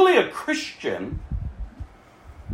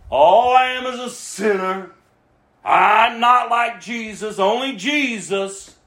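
mock excuse; slower than usual. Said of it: "I hear people say it all the time".

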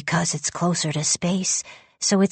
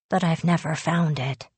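ordinary delivery

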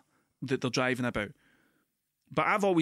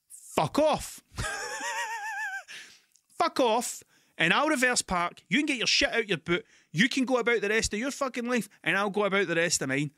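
much higher, loud telling-off; shouting it out loud; 7 semitones higher than usual. Retold or imitated. To the man at the car boot, a loud high angry dismissal. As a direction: send him packing, fed up. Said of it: "I'd said that out loud".

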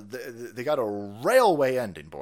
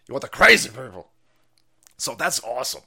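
normal talking voice

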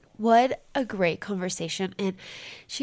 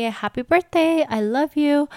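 much higher and louder, beaming; excited birthday wish; 7 semitones higher, 8 dB louder than usual. Real, not acted. Wife calling out birthday love to her husband, excited. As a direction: call it out with real joy.

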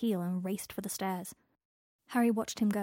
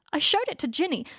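shouting; 7 semitones higher, 9 dB louder than usual. Acted. Draco shouting.

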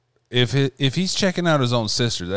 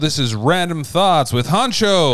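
announcer voice; 6 semitones higher, 4 dB louder than usual. Acted. Podcast show opening announcement.

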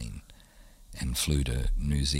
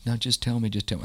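higher, urgent plea to be told; a lightly voiced wife begging to hear it; pleading wife; 7 semitones higher, faster than usual. Wife begging her husband to just tell her, urgently. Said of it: "She goes".

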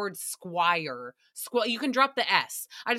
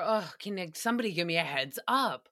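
usual speaking voice

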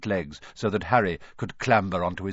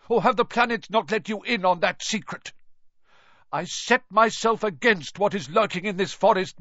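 higher, louder impassioned defiance; defiant shout; 10 semitones higher, 5 dB louder than usual. A narrator's reading. Firenze defies Bane before riding off, impassioned shout. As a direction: throw the challenge back at him.

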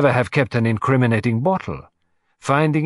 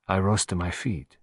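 ordinary delivery, nothing marked

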